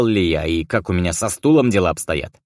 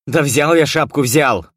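irritated shout; 7 semitones higher, 4 dB louder than usual. A narrator's reading. Hero snaps at grandpa.

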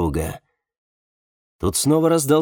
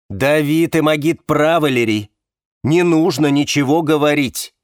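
loud, firm, higher-pitched command; cutting refusal; 4 semitones higher, 6 dB louder than usual. A narrator's reading. Man on the phone forbidding confession, cutting her off. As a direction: shut the idea down forcefully. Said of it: "отрезал Александр".

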